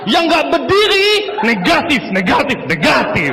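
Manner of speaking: high, loud hype shouting; mock yelling MC. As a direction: bellow at the crowd to stand up. MC yelling at audience to stand.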